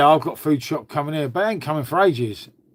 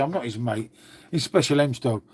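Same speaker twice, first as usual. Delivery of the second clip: usual speaking voice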